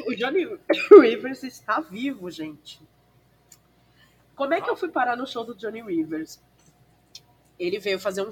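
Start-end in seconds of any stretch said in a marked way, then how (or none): 0.0-2.8 words broken by coughs; coughing. Real, not acted. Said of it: "tô até tossindo".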